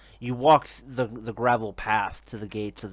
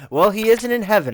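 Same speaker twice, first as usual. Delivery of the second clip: much higher and louder, still laughing; loud mocking retort; 11 semitones higher, 12 dB louder than usual. Leader mocking the Ripper's fate, loud and high. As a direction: crow it out with a laugh. NPC answers through the laughter.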